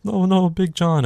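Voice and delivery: high, slow fake wail; drawn-out, high mock grief; mock anguish. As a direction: lament it in hammed-up dismay. Voicing Little John finding Big John.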